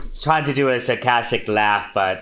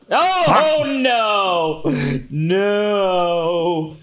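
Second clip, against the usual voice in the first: alarmed cry; 9 semitones higher, much slower than usual. Real, not acted. Player sees spooky enemy appear.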